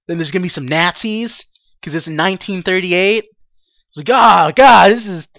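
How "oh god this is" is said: loud, higher, thrilled outburst; gleeful loud cheer; excited. Retold or imitated. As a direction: burst out with glee. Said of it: "I was, like, so excited".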